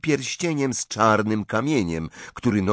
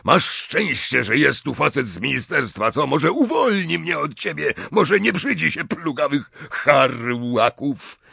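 higher, scornful angry rant; raised voice full of contempt; yelling; 6 semitones higher than usual. A narrator's reading. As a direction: berate her in a rough character voice. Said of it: "wrzasnął do córki".

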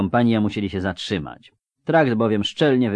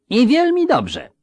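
fawning rogue voice; 7 dB louder than usual. Acted. Szczupak recovers, fawning.

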